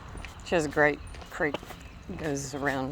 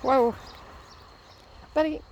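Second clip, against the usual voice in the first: calling the dog; 8 semitones higher than usual. Real, not acted. On a walk, calling out to stop her dog Bravo.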